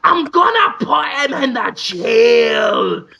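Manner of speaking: slow, wailing put-on shout. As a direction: drag every word out in a hammed-up cry. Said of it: "that's what [name] sounds like".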